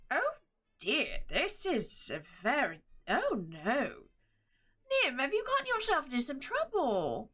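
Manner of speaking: swooping, fussy little-man voice; jolly gnome turned concerned. Acted. Gnomish husband reads Nim's letter, worried voice. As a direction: fret with big pitch glides. Game master plays Arnold.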